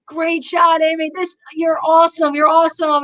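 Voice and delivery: high, enthusiastic praise; cheering praise. Retold or imitated. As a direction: cheer her on warmly. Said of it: "Gary's like".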